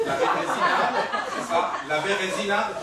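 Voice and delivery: laughing shout. Acted. Fake priest grabs absurd name.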